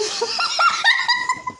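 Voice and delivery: high loud shrieking laughter. Real, not acted. After tattling on her friend, a loud shrieking laugh.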